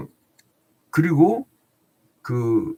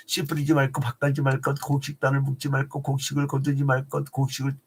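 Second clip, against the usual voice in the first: quick, monotone rattling-off; hurried recital of a long list; rapid-fire list. Real, not acted. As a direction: race through the rules on one level pitch. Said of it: "제가 빨리 얘기해요".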